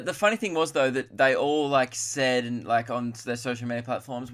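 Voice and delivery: ordinary talk, nothing marked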